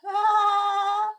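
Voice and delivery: loud, high, drawn-out mock laugh; hammed-up high "aah" of amusement; bee's laugh. The bee laughs at the crying animals, hammed-up laugh. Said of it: "then the bee left".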